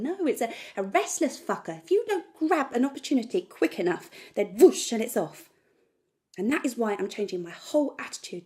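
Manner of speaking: very loud, worked-up declaration; fired-up, emphatic ranting; defiant rant. Acted. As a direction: hammer it out loudly, daring them to watch. Rant builds to a dare.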